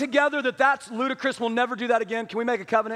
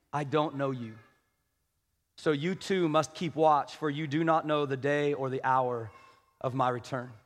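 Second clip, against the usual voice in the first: scripture reading; 6 semitones lower than usual. A narrator's reading. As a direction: read the parable solemnly toward its warning. Reads the parable aloud.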